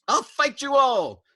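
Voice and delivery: loud, high, slow scream. A narrator's reading. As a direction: scream it in defiance. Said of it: "Dobbs screamed back at them".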